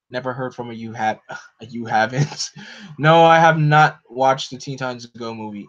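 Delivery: ordinary talk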